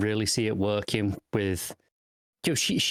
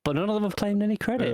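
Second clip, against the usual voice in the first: Quark impression; 11 semitones higher than usual. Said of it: "And then Quark's like".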